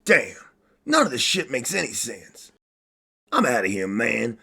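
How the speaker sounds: normal talking voice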